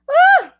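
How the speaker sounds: short high, loud squeal; sudden loud high yelp; excited squeal. Real, not acted. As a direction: let out a quick high-pitched "ah". Excited squeal before new topic.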